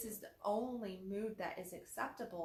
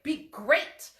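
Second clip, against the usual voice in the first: loud, high, emphatic "be great"; 5 semitones higher, 13 dB louder than usual. Shown as a demonstration. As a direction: push it out like a cheer. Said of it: "if you're gonna be great".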